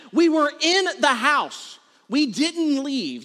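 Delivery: high, slow, emphatic disbelief; acted parental incredulity; exasperated dad. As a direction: spell it out to a child in exasperation. Exasperated dad arguing with son.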